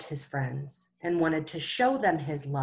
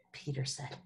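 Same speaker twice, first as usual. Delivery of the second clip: ordinary delivery, nothing marked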